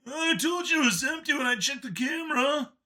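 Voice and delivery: high alien voice; defensive Howler. A narrator's reading. Second guard defending himself to the lead, high. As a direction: answer back defensively.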